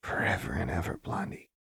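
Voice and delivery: soft murmur fading to whisper. A narrator's reading. Jinx answering Lux's vow, whispering. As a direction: whisper it back tenderly. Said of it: "she whispered back".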